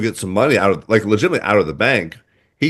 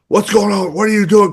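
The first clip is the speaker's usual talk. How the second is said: imitating alarmed grandpa; 9 semitones higher than usual.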